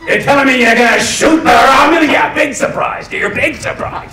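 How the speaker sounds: high, loud mock outrage; sitcom bickering. Crew member bickering over shooting the Romulan, loud mock outrage. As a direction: shout it in sarcastic exasperation, slowing at the end.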